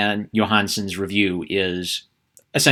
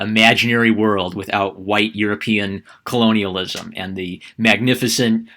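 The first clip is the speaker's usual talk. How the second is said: usual speaking voice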